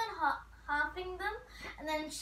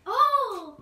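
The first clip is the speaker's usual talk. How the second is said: surprised; 5 semitones higher, 12 dB louder than usual. Real, not acted. Feeling new slime.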